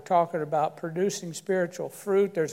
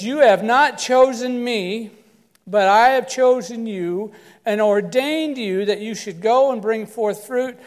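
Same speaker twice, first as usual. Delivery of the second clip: slow, louder, higher solemn reading; scripture reading; 4 semitones higher, 7 dB louder, slower than usual. Acted. Pastor reads John to the church, slow and solemn. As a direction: proclaim each word slowly.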